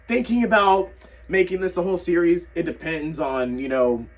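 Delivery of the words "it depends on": usual speaking voice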